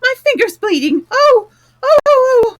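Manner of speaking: screaming queen in a panic; frantic scream. Acted. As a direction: wail about the finger at full volume. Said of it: "cried the Queen".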